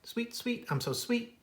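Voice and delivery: high, sweet little bird voice; high piping birdsong; sweet warbler song. Acted. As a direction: sing it up high like a tiny warbler. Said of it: "sings sweetly".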